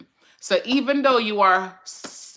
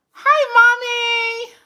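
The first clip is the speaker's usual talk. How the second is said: put-on high, slow little-girl "Hi, Mommy" with a teary edge; 6 dB louder, much slower than usual. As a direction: greet mommy like a tiny child. Voicing her little daughter.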